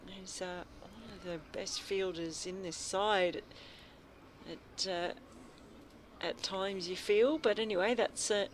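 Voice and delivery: lively ball-by-ball radio call; radio cricket commentator calling the over; cricket commentary. Acted. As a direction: call the play as it happens.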